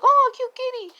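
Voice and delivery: very high cute squeal; high-pitched mock fawning; delighted cooing. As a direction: gush over an adorable animal. Cooing at a cat.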